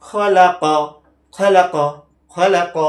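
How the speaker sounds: খলাক repeated loud, each syllable joined; teacher modelling খলাক, loud; loud model reading. Shown as a demonstration. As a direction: call out খলাক clearly three times. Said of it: "তিনটা হরফ একসাথে করে দিব".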